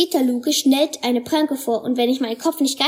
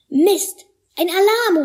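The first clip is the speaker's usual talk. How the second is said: higher, louder startled exclamation; alarmed cry; 6 semitones higher, 4 dB louder, slower than usual. A narrator's reading. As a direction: blurt it out in alarm. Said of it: "rufe ich".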